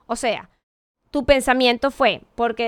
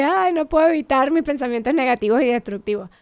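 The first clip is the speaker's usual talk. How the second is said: ordinary talk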